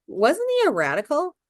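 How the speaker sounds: high naive question. A narrator's reading. Mildred asks Montag innocently, high and light. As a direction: ask it brightly, without a clue.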